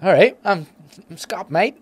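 high, loud, hammed-up Australian accent; bad Australian accent. Retelling the Scottish coffee guy's greeting in a bad Australian accent. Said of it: "I can't do an Aussie accent".

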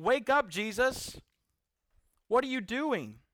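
high, loud, exasperated pleading; freaking-out disciples. Acted. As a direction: shout to wake a sleeper in a crisis. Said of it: "you're freaking out. That's what the disciples are doing".

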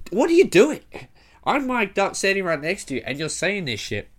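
high, loud, incredulous outburst; loud, high-pitched telling-off; exasperated scolding. Retold or imitated. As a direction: scold in disbelief, starting at a peak and easing down. Scolding dad for blurting while mic'd.

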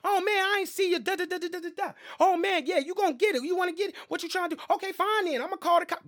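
hammed-up high-pitched rant of the lady who hit the mirror; mimicking the angry lady. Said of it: "this lady hop out and start going".

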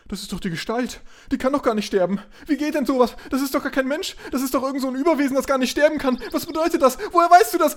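high, frantic disbelief; high and rushed, losing grip; rising panic. Acted. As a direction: voice climbing into hysteria.

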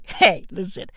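usual speaking voice

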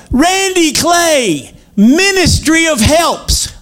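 high, slow, drawn-out call of his name; stretched high fanfare call; excited greeting. Retold or imitated. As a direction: sing out the name like a celebrity welcome. Men in line calling his name.

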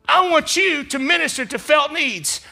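loud, high preaching build; full-force preaching. Acted. Pastor preaching loudly to the congregation. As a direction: preach it out to the church.

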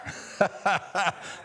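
breathy chuckle at the joke; quick airy laugh; light laugh under the breath; short unvoiced laugh. Real, not acted. Laughs at own example.

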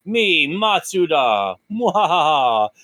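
slow, hammy evil gloat; villain gloat. Pretend. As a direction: drag out a mock evil laugh. Mock villain laugh as Matsuda.

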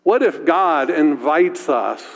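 ordinary delivery

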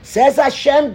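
fiery rendering of the verse; preaching the verse. Shown as a demonstration. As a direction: proclaim God's warning. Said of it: "One more time".

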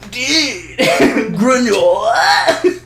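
high, stretched-out goofy catchphrase; hammed-up dude voice; Rad Chad. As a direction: draw both words out way up high. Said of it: "it was just me going".

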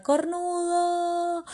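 high, slow drawn-out taunting yell; mocking shout. Biker taunting the jogging man, long mocking shout. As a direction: stretch the insult out high and loud. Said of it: "le grita".

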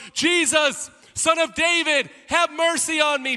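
high, loud desperate scream; screaming plea. Acted. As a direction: scream it across the crowd to be heard. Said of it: "he screams from the top of his lungs".

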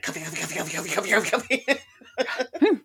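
mock frantic chant rising into laughter; coffee-crazed chewer. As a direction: imitate manic munching, repeating the word and climbing. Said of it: "And he chews it down".

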